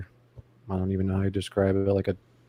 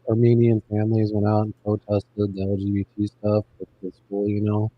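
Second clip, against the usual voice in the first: underwater sound. Real, not acted. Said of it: "you sound like you're underwater".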